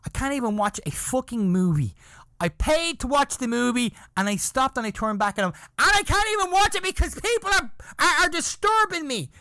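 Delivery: put-on high, heated kid's outburst; retold angry rant. Boy ranting at the man who spoiled his movie, high and furious. Kid ranting again, turning back.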